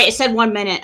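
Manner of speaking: ordinary talk, nothing marked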